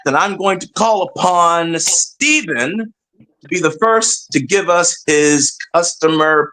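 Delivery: ordinary delivery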